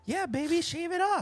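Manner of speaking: very high sweet voice; Mel's voice. Retold or imitated. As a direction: pitch way up playing the wife. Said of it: "when Mel was like".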